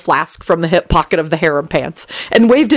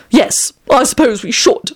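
sniffy; 7 semitones higher than usual. A narrator's reading. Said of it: "Tony sniffed".

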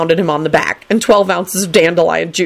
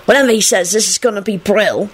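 higher-pitched scornful declaration; pitched-up boy's put-down; declaring; 4 semitones higher than usual. A narrator's reading. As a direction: declare it dismissively. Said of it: "Tim declared loudly".

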